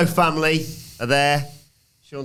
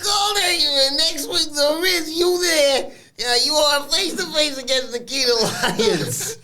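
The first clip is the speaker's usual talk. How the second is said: mock Italian wiseguy; slower than usual. Voicing wrestlers in a recap.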